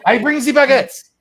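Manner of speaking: high, loud, drawn-out comic cheer; eager mock Frenchman. French ally joins the war with baguettes, loud hammed-up eagerness. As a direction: belt it out slowly as a gung-ho French volunteer.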